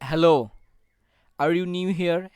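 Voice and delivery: loud greeting with a rising tune. Shown as a demonstration. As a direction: lift your voice on "Hello". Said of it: "In this sentence our voice go up". (শুভেচ্ছায় কণ্ঠস্বর উপরে তোলা)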